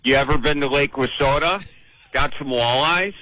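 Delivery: put-on thick Upper Midwest Wisconsin accent. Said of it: "in that wonderful Wisconsin accent".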